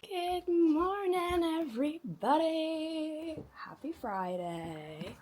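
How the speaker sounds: high, slow cheery vlog hello; slow drawn-out greeting. Acted. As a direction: stretch out the greeting brightly.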